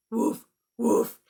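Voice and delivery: playful puppy woof; dog bark. Acted. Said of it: "what would Biscuit say".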